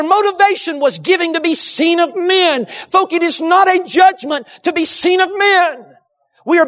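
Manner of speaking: preaching shout. Real, not acted. Preacher exhorting congregation.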